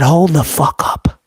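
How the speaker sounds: low, slow, indignant hold-up; mock outrage. To a livestream commenter, mock indignant. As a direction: play offended at being called thirty-five.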